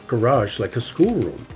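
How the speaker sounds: usual speaking voice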